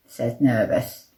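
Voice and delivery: ordinary delivery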